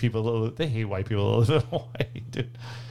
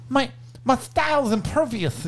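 high, slow, lisping boxer; a monster with a child's voice, drawn out; Mike Tyson; 10 semitones higher, slower than usual. Retold or imitated. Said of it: "he used to talk like that".

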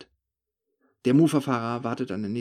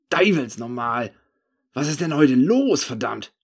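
laut zu sich selbst; 5 dB louder than usual. A narrator's reading. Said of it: "sage ich laut zu mir selbst".

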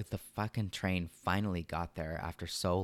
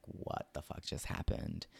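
hushed, slower disbelief; stunned whisper; 3 semitones lower, 7 dB quieter than usual. Retold or imitated. Recalling the fight to a friend, a stunned whisper. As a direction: breathe it out in quiet shock. Retelling shock after fight.

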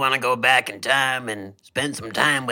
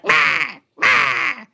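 very high imitated dinosaur squawks; high-pitched T-Rex squawk; 4 dB louder than usual. Imagining what a T-Rex sounds like, high-pitched squawk. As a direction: squawk twice in a tiny, piercingly high voice. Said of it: "real high-pitched voice like".

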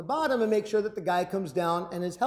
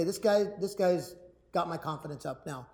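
ordinary delivery

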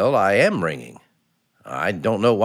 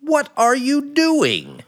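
high, drawn-out outraged cry; exasperated; 13 semitones higher, slower than usual. Acted. Husband ordering wife up off the floor, exasperated. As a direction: scold in slow, high-pitched disbelief. Husband appalled by wife's act.

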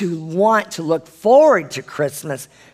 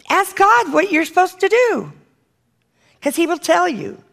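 emphatic exhortation; 12 semitones higher than usual. Real, not acted. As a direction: voice pitched way up, insistent. Urgent exhortation to the congregation.

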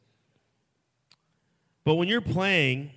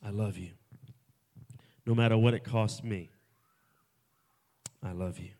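low and soft, very hushed; tender I love you; 6 semitones lower, 4 dB quieter than usual. Acted. Father telling us softly he loves us. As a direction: say it gently as the Father. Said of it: "the same thing the Father says".

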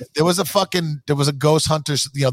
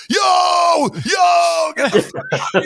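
high shouting freak-out imitation; mocking ghost hunters; 4 dB louder, slower than usual. Mocking ghost hunters panicking at a noise, high shout. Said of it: "everybody's like".

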